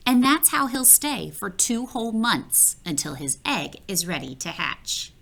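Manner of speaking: usual speaking voice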